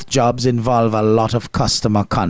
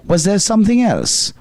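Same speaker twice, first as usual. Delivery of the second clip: higher-pitched, courteous question; concerned, polite; 7 semitones higher than usual. Acted. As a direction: ask with a frozen customer-service smile. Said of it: "concerned and politely either".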